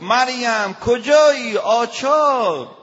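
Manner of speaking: very high, very loud calling out, آچار dragged very slowly; husband yelling. Retold or imitated. Husband bellowing at his wife Maryam for the wrench. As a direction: yell across the house for the wrench.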